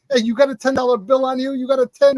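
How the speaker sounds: put-on high-pitched New York kid calling his pal; Jersey New York accent. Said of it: "New York accent".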